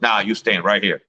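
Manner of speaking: ordinary delivery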